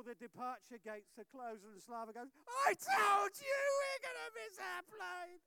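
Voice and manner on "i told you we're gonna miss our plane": loud, high, crying I-told-you; tearful shout. Retold or imitated. Pastor voicing Slava at the closed gates, high tearful shout. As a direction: wail it in despair.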